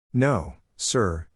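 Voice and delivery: plain reading voice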